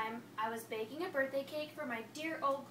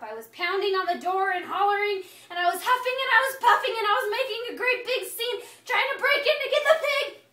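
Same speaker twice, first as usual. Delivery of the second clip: much higher and louder, getting worked up; worked-up telling; 7 semitones higher, 12 dB louder than usual. Real, not acted. Wolf tells how he stormed the door, worked up loud. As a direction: relive the rage, building loud. Wolf recalls raging scene.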